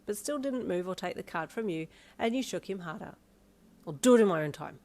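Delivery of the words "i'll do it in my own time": louder short snap; snapped. A narrator's reading. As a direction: snap back at her. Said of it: "he snapped".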